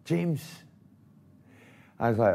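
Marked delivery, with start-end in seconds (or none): 0.0-0.6 high, choked repeating of James; overwhelmed with feeling, calling his name; emotional Tommy. Retold or imitated. [name] imitating tearful Tommy Burns after the cup win. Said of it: "And he goes".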